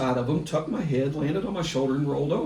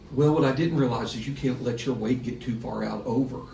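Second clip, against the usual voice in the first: normal talking voice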